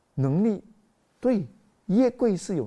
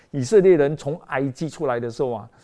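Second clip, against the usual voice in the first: ordinary talk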